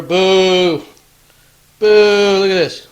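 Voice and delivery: loud drawn-out boo; mock jeering. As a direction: heckle like a rowdy crowd. Jeering the False Emperor.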